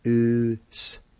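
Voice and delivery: "Usquatin" slowly, in separate syllables; slow, broken-up take of "Usquatin"; syllable-by-syllable demo. Shown as a demonstration. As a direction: sound out "Usquatin" with gaps. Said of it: "It's pronounced like this".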